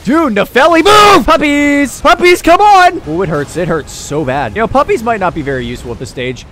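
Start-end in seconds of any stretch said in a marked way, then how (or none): 0.0-3.0 high, loud yells to allies; exasperated high-pitched rallying cry; urgent calling out. Real, not acted. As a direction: shout at them to get moving.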